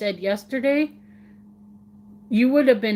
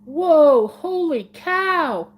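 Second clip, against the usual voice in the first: louder "whoa", then a slow, high "holy cow"; retold amazement; 6 semitones higher, 5 dB louder, much slower than usual. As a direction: replay your own astonished double take. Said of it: "I'm like".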